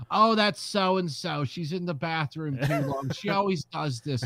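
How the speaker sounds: put-on Long Island accent. Podcaster mimics the teller in a Long Island accent. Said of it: "this Long Island accent".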